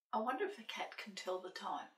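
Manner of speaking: ordinary delivery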